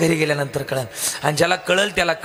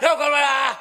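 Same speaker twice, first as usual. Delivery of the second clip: urgent shout; 7 semitones higher, slower than usual. Retold or imitated. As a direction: yell at the others to haul it up. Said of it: "हे म्हटलं". (घाईघाईने ओरडणे, उंच आवाजात)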